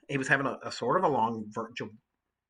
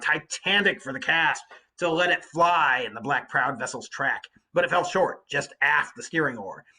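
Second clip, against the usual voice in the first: story narration; 5 dB louder than usual. A narrator's reading. Teacher reading Homer to the class, clear story narration. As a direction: read out steadily and a little louder, like an epic.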